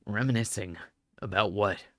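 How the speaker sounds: low puzzled reply; low skeptical man. Acted. Other man questions.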